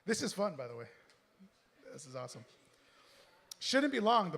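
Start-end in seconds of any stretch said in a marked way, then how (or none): 3.6-4.3 louder, higher hammed-up Italian accent; Italian accent try. Reader redoing the bus driver line with a louder mock Italian accent. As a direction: push the Italian lilt harder this time. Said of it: "what accent were you going for? Italian".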